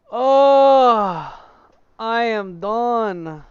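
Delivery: high, loud, slow drawn-out cry; triumphant cry. Real, not acted. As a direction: belt out the victory. Triumphant cry after winning.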